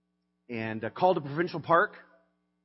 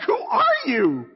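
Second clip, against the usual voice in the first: slow, stunned disbelief, voice breaking with emotion; half-laughing, teary amazement; incredulous who are you; 9 semitones higher, 5 dB louder, much slower than usual. Retold or imitated. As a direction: ask it as if you can't believe this person exists. Wife invites attendant to church.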